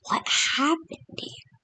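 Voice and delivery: hushed whispered question. Retold or imitated. Algerians staring at the wreckage, whispering in shock. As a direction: breathe it out in a stunned whisper. Algerians find nuked desert.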